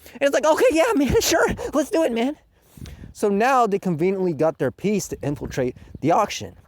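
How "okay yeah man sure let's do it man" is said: high-pitched chummy impression; mock eager agreement. Two characters agreeing on a mission, mock-keen. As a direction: agree far too readily, hammed up.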